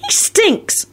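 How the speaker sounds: very high-pitched complaint; bluntly disgusted; rude blurt. A narrator's reading. Ginny blurting about Mundungus's smell, rude. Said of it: "Ginny blurted out rudely".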